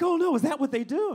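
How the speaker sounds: high, loud, shocked gasp. Retold or imitated. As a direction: clutch pearls in shock. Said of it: "They were like".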